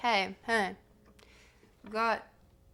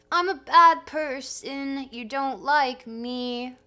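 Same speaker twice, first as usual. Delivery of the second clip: whiny self-pity; 4 semitones higher, 11 dB louder, slower than usual. Acted. Acted self-pitying quote.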